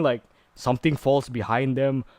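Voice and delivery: ordinary talk, nothing marked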